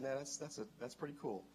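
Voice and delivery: soft, subdued quoted reply; retold calm acceptance. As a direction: speak quietly, the anger gone. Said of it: "he calmed down".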